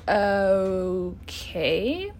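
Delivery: drawn-out okay, rising into a question; long, questioning retold "okay"; baffled okay. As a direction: stretch it out, wary and confused. Baffled reply to funeral home question.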